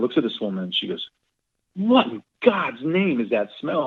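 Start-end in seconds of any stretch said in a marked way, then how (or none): 1.7-3.9 higher, slower, horrified put-on voice; imitating disgusted wife. Wife to a local woman about the stench, higher and slower in mock disgust. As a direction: play the wife recoiling from a stench, drawn out.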